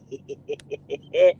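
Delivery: high quick giggle; light rapid string of giggles; laugh. Real, not acted. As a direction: giggle to yourself. Laughing at own throat burn.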